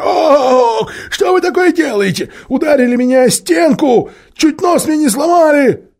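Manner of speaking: very high, loud pained howl; wailing yell. A narrator's reading. Dwarf rubbing his nose after being dragged out, wailing. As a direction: wail in outrage over a hurt nose. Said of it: "потирая нос и вопя".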